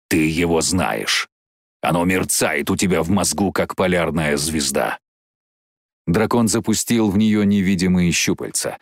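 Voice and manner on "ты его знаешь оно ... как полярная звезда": low, measured creature voice; deep, ominous dragon; insinuating dragon. Acted. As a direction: press the point, deep and deliberate. Dragon presses Jane.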